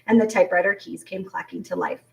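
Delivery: ordinary delivery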